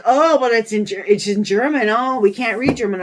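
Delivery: loud, whiny officer voice; mock their excuse; mocking customs officer. Mimicking customs who claim they can't read German, loud and whiny.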